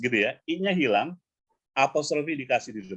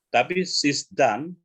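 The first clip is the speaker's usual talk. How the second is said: slow demo; 3 semitones higher, 3 dB louder, much slower than usual. Shown as a demonstration. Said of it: "contoh ya".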